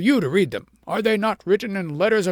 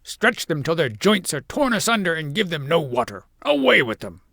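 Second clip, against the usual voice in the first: much louder, higher, commanding; furious shout; 4 semitones higher, 7 dB louder than usual. A narrator's reading. King orders the family to the rack, loud and furious. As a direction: order it in fury. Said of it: "shouted the king".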